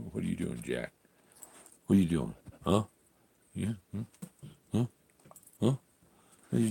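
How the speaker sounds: soft to the dog. Real, not acted. Owner quietly asks the dog what he is doing. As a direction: murmur a question down at the dog.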